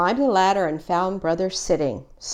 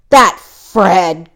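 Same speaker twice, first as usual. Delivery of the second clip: growling; 10 dB louder than usual. A narrator's reading. Brother growling at Fred for taking the bike. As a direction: snarl his cousin's name through clenched anger. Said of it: "growled Brother".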